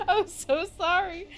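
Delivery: high, loud apology through tearful laughs; crying with laughter. Real, not acted. Streamer apologising to the internet, crying with laughter. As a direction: squeak out sorry while laughing.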